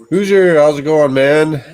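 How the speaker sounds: high, loud hearty hello; loud greeting. Real, not acted. Streamer hailing a viewer in chat, loud and high. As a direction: call out the name with a big welcome.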